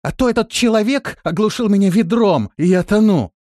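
high, very loud complaint from below; urgent cry. A narrator's reading. Drowning woman calling up from the well, urgent cry. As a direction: cry out for rescue.

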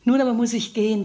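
ordinary talk

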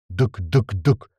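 slow, low repeated thuds; launcher fire imitation. A narrator's reading. Narrator voices grenade launcher shots, slow thuds. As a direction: thump out each shot separately.

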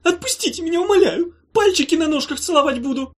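high, loud grovelling plea; begging creature. Acted. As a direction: beg for mercy in a squeaky voice.

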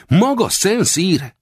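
slow, high question of amazement; astonished cry. A narrator's reading. Narrator asking the captive if he is that hunter, high and amazed. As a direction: ask slowly, high with disbelief. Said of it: "kiáltottam fel álmélkodva".

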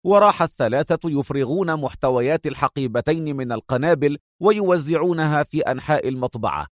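plain reading voice

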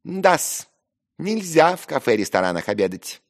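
Ферфичкин, insolent. A narrator's reading. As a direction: add it with impudent relish. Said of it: "нагло прибавил Ферфичкин".

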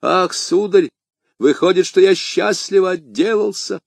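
high-pitched servant shaken by fear; frightened servant. A narrator's reading. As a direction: blurt out relief, still scared. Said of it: "еле живой от страха".

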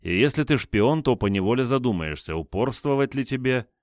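ordinary delivery

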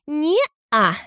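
high, cocky "nah"; cheeky refusal. Acted. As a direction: brush her off with a smirk. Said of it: "Нахально уставилась она на меня".

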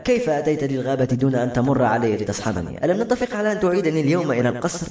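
ordinary delivery, nothing marked

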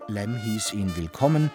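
ordinary delivery

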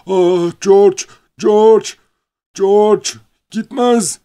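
high, loud desperate cry; shouting. Acted. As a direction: scream his name in despair. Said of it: "diye bağırdı". (çaresizce, tiz ve yüksek sesle bağırarak)

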